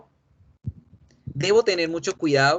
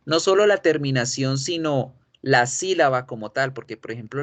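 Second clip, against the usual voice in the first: usual speaking voice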